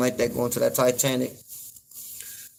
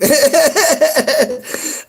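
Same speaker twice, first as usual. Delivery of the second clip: loud high laugh; 13 semitones higher, 7 dB louder than usual. Real, not acted. Laughing at his own quote about politicians, high and loud.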